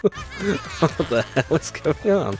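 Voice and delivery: high, slow, laughing disbelief; laughing exasperation. Real, not acted. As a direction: let the laugh take over the question. Confused by glitch.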